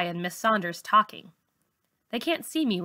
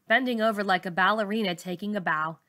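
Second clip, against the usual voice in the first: plain reading voice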